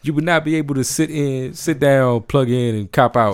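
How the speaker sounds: loud militant recitation. Retold or imitated. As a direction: proclaim each command at full voice. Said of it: "I was on some real militant shit".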